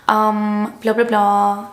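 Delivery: mock-fancy British chatter; playful fake British; pretend British accent. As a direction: babble on in a put-on British accent. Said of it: "I like to talk like that".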